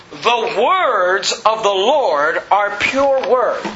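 slow, measured verse with WORDS stretched out; deliberate weight on WORDS, drawn out; stress on WORDS. Shown as a demonstration. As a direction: read it very slowly, leaning on each "words". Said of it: "the emphasis on the word words".

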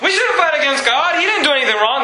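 high, fast, urgent defense of Paul; pleading defense. Retold or imitated. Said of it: "And they're".

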